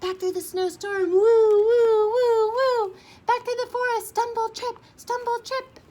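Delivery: high, hurried mock panic; frantic retreat. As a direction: rush back like the bear is chasing. Fleeing the bear in a hurry.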